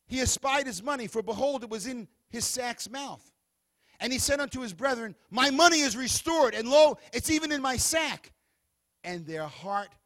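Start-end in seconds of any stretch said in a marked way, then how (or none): 5.3-8.3 a brother blurting out, louder, surprised; startled discovery. Acted. Brother to his brethren, finding money, startled and loud. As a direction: announce the find with alarm.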